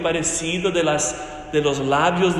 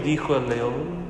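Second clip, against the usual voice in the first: usual speaking voice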